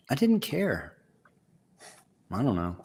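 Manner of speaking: normal talking voice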